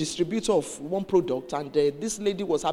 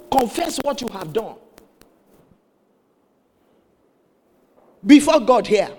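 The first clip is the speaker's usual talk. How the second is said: higher emphatic command, building loud; retold stern pastor; 4 semitones higher, 9 dB louder than usual. Pastor demands confession from the woman, forceful retold command. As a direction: press the order, landing loudly on God. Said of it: "Pastor said".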